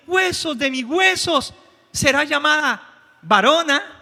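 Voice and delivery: man overwhelmed by the woman's beauty, shouting with joy; excited shout. Retold or imitated. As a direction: marvel out loud at her beauty. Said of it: "él gritó emocionado y dijo".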